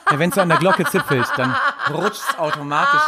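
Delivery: high, laughing explanation; real laughter. Real, not acted. Gargler explains to co-host how swallowing happens, laughing. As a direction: explain it through rising giggles.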